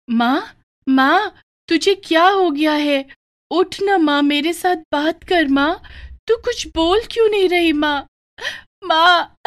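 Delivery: put-on a scared girl shaking her unconscious mother; high, tearful and desperate; panicked crying child. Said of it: "Radhika जोर-जोर से रोने लगी".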